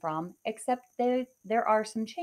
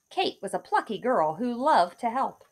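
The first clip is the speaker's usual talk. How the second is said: ordinary delivery, nothing marked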